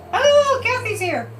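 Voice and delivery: a high, bright "Oh" of sudden delight; light up as a friend walks in; happy little gasp of recognition; surprised greeting. Real, not acted. Notices a viewer arriving.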